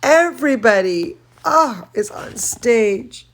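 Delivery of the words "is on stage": usual speaking voice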